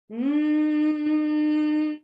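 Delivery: a long, high, loud humming buzz; high bee buzz hum. Shown as a demonstration. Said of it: "There'll be a higher pitch to start with".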